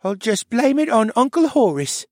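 put-on high, dreamy child voice, fretful; a dazed little boy worried he'll be scolded; high, dazed child voice with rising worry; sleepwalking boy. A narrator's reading.

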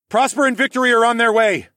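high, loud call over distance; shouting ahead. A narrator's reading. Alex alerting Horace that rescue is coming, shouted. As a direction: call out urgently to someone in front. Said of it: "I shouted ahead at Horace".

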